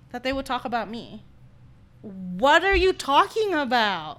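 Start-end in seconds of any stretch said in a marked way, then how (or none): 2.0-4.2 loud, slow, higher incredulous question; exaggerated bafflement; acted disbelief. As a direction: draw out a baffled challenge to a friend. Acted baffled reply to Jennifer.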